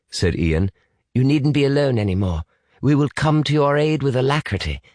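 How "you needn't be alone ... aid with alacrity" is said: higher, proper British voice; formal British reassurance. Acted. British boy promises rescue to lost child, formal and reassuring. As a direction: reassure stiffly in a British accent.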